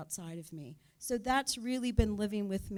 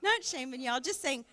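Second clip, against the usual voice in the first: playful teasing nudge; 5 semitones higher, 4 dB louder than usual. Acted. Playful nudge to attend group.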